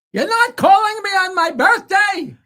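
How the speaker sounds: high, loud, indignant phone rant; imitating an old comic's outraged yell. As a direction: holler a complaint down the phone. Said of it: "called up to yell at me".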